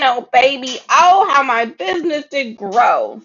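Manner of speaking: ordinary delivery, nothing marked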